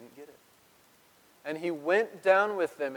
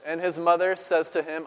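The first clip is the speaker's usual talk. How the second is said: normal talking voice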